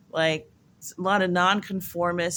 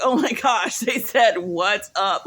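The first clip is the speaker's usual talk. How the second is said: excited laughing quote; 6 dB louder, slower than usual. Retold or imitated. Friend excited about German greeting.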